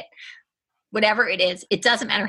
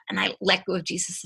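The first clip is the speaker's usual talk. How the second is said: ordinary talk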